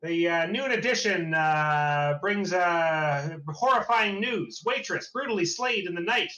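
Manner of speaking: high, loud paperboy cry; newsboy yelling out. Acted. Boy with newspapers hawks the noon edition, yelling out. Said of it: "yells out".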